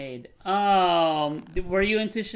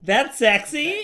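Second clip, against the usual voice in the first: tickled; 9 semitones higher, 6 dB louder, much slower than usual. Real, not acted. Delighted, laughing reaction to the accent.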